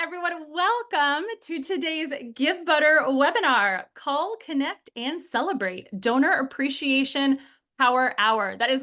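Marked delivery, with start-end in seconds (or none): none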